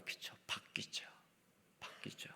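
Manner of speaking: breathy hushed whisper, barely voiced. Preacher hushing a point to the congregation, whispering. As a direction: let it drop to a secret whisper.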